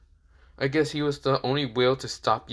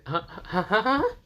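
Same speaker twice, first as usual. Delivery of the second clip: rising baffled huhs; stunned confusion; confused huhs; 10 semitones higher than usual. Acted. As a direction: stammer out bewildered huhs. Confused repeated huh.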